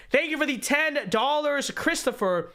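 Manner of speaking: normal talking voice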